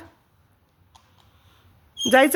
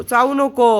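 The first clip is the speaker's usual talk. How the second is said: चावू नको repeated louder, नको pressed down firmly; second warning with more force, falling hard; firmer louder no; 3 semitones higher, 5 dB louder than usual. Real, not acted. Bird keeps biting her. (जास्त मोठ्याने ठाम इशारा)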